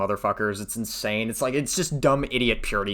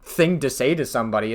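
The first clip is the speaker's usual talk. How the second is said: ordinary talk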